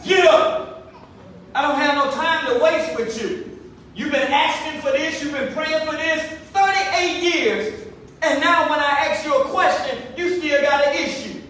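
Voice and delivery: high, loud, stern scolding; authoritative rebuke. Acted. Jesus scolding the sick man for his excuses, loud stern rebuke. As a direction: lay into him with authority. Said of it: "saying it with authority".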